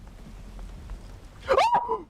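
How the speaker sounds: a sudden shocked inhale; high sharp gasp. Real, not acted. Player caught by the hunter, sharp shocked gasp. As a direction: gasp as he grabs you.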